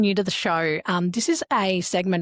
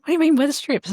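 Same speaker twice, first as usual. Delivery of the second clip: incredulous; 8 semitones higher, 4 dB louder, faster than usual. Retold or imitated. Retelling incredulous reply.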